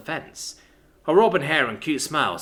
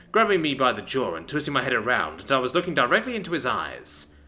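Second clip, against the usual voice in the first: ordinary delivery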